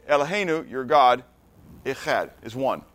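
measured phrase-by-phrase recitation. Shown as a demonstration. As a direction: pause after each Hebrew word and its translation. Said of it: "but I'll speak it slower".

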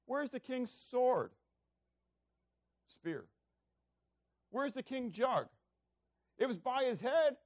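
high, loud rebuke called from afar; calling across the valley to shame the general; scolding shout. Retold or imitated. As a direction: tear into the general for failing. David rebukes Abner from the hillside.

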